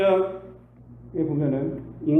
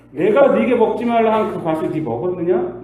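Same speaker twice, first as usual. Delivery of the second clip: loud, pointed questioning; God confronting Adam about the fruit; stern God; 4 semitones higher, 8 dB louder than usual. Acted. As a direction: press Adam with the accusation.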